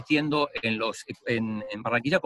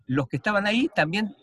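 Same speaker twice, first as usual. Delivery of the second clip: ordinary talk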